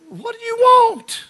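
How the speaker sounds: very high, loud, drawn-out yell; exasperated shout. Acted. Elijah turning on his follower, exasperated shout. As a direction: holler it out in exasperation.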